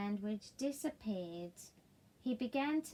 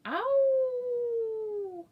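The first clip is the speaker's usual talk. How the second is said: high, loud yelping bark; high loud dog yelp; 13 semitones higher, 8 dB louder than usual. Acted. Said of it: "Siberian wild dog went".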